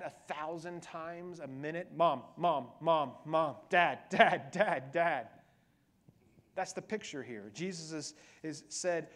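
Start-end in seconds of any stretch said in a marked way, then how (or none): 1.9-5.3 put-on kid calling out repeatedly. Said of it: "they said".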